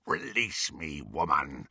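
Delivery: low, soft werewolf threat; menacing werewolf. A narrator's reading. Helpless werewolf to his captor, low menace. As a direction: demand it low through bared teeth. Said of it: "He bared his pointed teeth".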